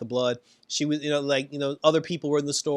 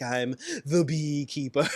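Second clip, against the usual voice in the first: proud reveal; slower than usual. Retold or imitated. Rita proudly reveals hero identity.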